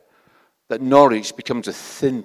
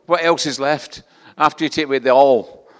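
ordinary talk, nothing marked

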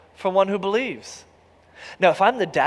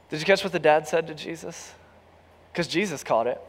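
ordinary talk, nothing marked

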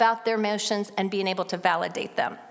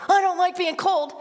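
put-on child complaining; 7 semitones higher, 4 dB louder than usual. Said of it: "But if you say".

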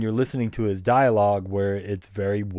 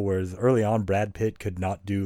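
ordinary talk, nothing marked

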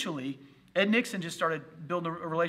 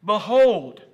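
"Behold" proclaimed much louder; emphatic behold; 3 semitones higher, 13 dB louder than usual. Shown as a demonstration. Pastor proclaiming the Greek word, loud. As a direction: boom out "Behold" like a herald. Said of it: "The word that's there in the Greek is behold".

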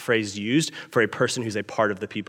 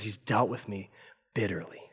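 much softer, heavy with grief; hushed, bitter; 4 semitones lower, 10 dB quieter than usual. Retold or imitated. Preacher voicing Naomi's bitterness to the church, hushed. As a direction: let the words sink low and quiet.

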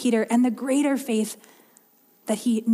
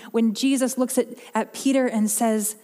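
usual speaking voice

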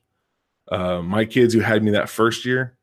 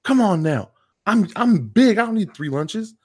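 much higher, louder mock disbelief; incredulous scolding; 12 semitones higher, 5 dB louder than usual. Teacher telling the kid he doesn't need three lunches, high incredulous scolding. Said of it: "And I'm like".

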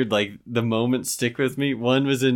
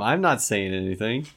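normal talking voice

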